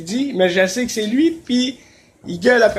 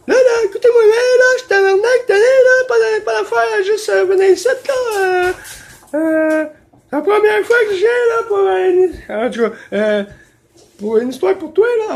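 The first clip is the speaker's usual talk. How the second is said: much higher and louder, screechy landlady; shrill angry landlady; 13 semitones higher, 5 dB louder than usual. Retold or imitated. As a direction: scream it like the furious landlady. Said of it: "la propriétaire a dit". (propriétaire hystérique imitée, très aiguë et forte)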